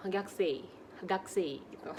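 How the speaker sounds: "gakusei" said as gaksei; u dropped. Shown as a demonstration. Teacher modelling a natural "gakusei" without the u. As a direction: swallow the u in "gakusei". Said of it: "u-nya dihilangin gitu".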